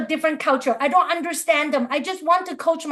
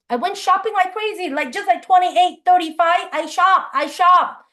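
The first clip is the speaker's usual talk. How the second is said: excited; 6 semitones higher, 4 dB louder than usual. Retold or imitated. Shopping spree told excitedly.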